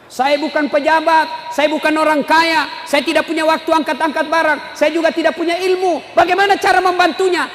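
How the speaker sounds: high, mounting list of excuses; rousing sermon cadence; insistent preaching. Real, not acted. Preaches at full force.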